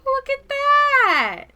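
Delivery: high, slow, amazed; excited gasping wonder. Real, not acted. Streamer marveling at coral in the game, awed and excited. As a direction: marvel at it out loud.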